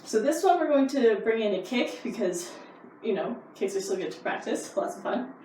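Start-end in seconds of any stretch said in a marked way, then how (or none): none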